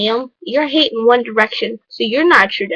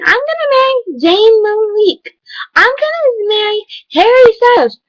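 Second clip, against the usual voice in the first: hammed-up lovestruck fan voice; mocking swooning fangirls; 8 semitones higher than usual. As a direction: parody fans swooning over each band member. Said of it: "everybody's like".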